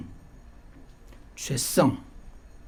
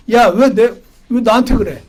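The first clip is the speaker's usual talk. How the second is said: irritated God; 5 semitones higher, 7 dB louder than usual. Pretend. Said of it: "하나님은".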